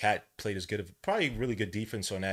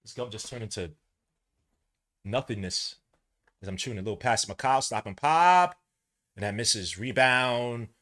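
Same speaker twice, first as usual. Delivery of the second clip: normal talking voice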